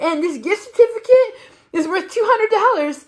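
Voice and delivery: high, giggly, teetering on tears; squeaky laughing quote. Retold or imitated. Telling the tattoo artist about her gift, high squeaky giggle.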